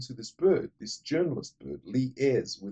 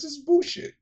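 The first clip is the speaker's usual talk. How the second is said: high mock woman's protest; higher, hammed-up woman. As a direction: squeak it out like the journalist. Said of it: "She goes".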